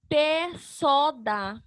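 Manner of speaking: very slow, each syllable held apart; drawn-out separate syllables. Shown as a demonstration. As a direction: sound out PÉ, SÓ, DÁ one by one. Sounding out syllables, then blending them.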